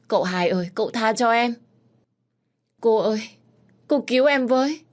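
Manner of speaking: begging for mercy. Acted. Maid begs master and mistress to save her, desperate and high. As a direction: beg as if her life depends on it. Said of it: "cúi đầu van xin".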